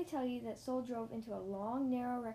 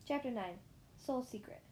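plain reading voice

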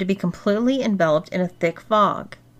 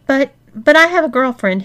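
higher, louder pleading moan; moaned protest; 6 semitones higher, 6 dB louder than usual. A narrator's reading. Man protesting to the women, moaned. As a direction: stammer out a moaning objection. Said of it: "he moaned".

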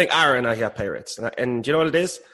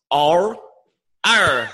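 drawn-out pirate R, rising louder; pirate accent; 4 semitones higher, 3 dB louder, much slower than usual. Pretend. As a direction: growl the R like a pirate. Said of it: "are you working on your Midwestern accent or your pirate accent".

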